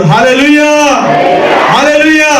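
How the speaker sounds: high ringing hallelujah cry to the church; hallelujah call. Real, not acted. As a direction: call it out high and strong to the people. Preacher calls out to congregation.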